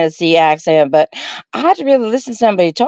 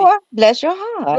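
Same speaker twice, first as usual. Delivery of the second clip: "bless your heart" much higher and slow, sugary sarcasm; sarcastic bless your heart; 13 semitones higher, slower than usual. Shown as a demonstration. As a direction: make "bless your heart" a sweet insult. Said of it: "every time you say it in a different tone".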